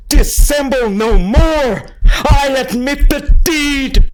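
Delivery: high, loud, slow, every word wrenched out; screamed confession. Acted. Murderer screaming his confession to the police, desperate. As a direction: confess at the top of your lungs.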